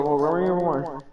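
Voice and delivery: normal talking voice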